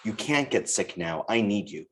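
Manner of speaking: ordinary delivery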